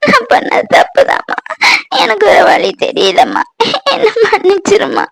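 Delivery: high, sobbing plea for forgiveness; crying. Acted. Daughter begging her mother's forgiveness for leaving, crying hard. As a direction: weep through the whole confession. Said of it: "அழாத சத்யா".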